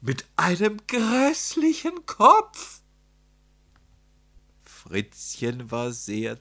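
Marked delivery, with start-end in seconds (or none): 0.0-2.8 very high, loud, slow shriek; horrified screaming at the top of the voice; terrified scream. A narrator's reading. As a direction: shriek it out like a panicked crowd. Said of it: "schrien sie".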